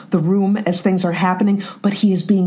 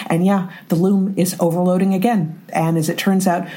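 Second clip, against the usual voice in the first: ordinary delivery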